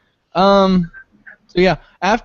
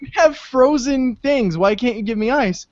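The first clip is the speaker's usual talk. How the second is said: exasperated protest; 8 semitones higher than usual. Retold or imitated. Customer pressing the counter guy about ice, exasperated. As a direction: reason in disbelief, pitched up.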